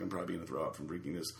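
low, quick, quiet retold thought; private queasy aside. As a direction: say it fast under your breath. Retold private thought after the drink.

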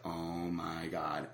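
slow, low, quiet disbelief; stunned aside. Retold or imitated. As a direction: let it out slowly under your breath. Retold stunned reaction on seeing them.

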